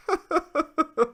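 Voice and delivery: laughing. Real, not acted. Laugh after the rant.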